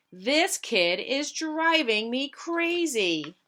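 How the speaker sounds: mock fed-up mother; exasperated mother. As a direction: let the frustration spill out.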